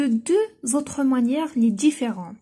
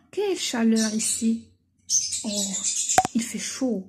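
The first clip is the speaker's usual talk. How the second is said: whispered; 2 semitones lower than usual. Shown as a demonstration. Teacher reads exclamation example.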